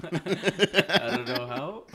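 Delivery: high, slow, laugh-filled protest. Real, not acted. Told to talk like a man, laughing helplessly. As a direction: crack up while saying it. Giggling over mic voice test.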